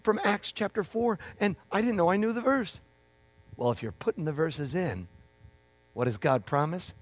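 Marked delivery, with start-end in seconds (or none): 0.0-2.8 much higher at first, then high and rushing; telling spouse about Walmart witnessing, high excited rush. Retold or imitated. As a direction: burst in on your spouse with the news. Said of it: "go to your wife or your husband and say".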